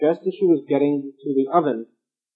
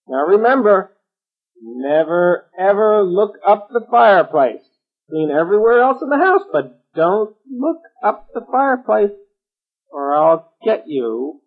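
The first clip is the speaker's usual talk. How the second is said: witch voice; 6 semitones higher, 5 dB louder than usual. A narrator's reading. Witch warning the girl.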